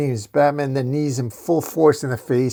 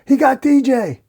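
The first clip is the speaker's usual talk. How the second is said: high, loud frantic shout; acted panicked scream; screaming alarm; 12 semitones higher, 7 dB louder, slower than usual. A narrator's reading. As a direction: raise the alarm to the gang. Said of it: "rides back to the clubhouse screaming".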